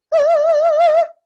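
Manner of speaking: very high, loud sustained "hoo"; piercing high head-voice note; head-voice hoot. Shown as a demonstration. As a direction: trill high up where the birds are. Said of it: "head voice".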